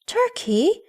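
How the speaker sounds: high exclamation of concern; exclaimed. A narrator's reading. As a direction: call out the pet name in sudden alarm. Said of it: "she exclaimed".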